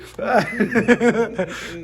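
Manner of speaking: hearty, drawn-out laugh. Real, not acted. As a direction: burst out laughing at the joke. Laughing at a class joke.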